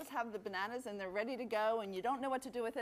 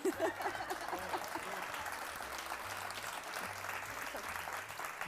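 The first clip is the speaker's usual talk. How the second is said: silent laugh; 4 dB quieter than usual. Real, not acted. Laughing at the joke.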